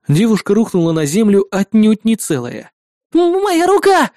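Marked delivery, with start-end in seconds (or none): 3.1-4.1 high, pained cry of terror; fear and pain. Acted. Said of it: "В голосе я отчётливо слышал страх и боль".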